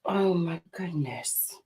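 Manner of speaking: unvoiced, slow whispered oh my goodness; whispered gasp. Real, not acted. Host whispers in shock at the vegan news. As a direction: breathe it out in shock.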